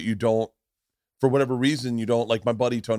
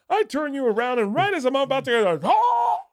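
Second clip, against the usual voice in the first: very high, excited put-on voice; hammed-up contestant; 3 dB louder than usual. Contestant cut off mid-act on dating show, hammed-up. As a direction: climb to a high mock cry at the end.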